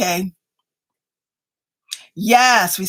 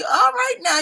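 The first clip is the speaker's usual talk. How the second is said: excited; 12 semitones higher than usual. Real, not acted. Teasing viewer about bell bottoms.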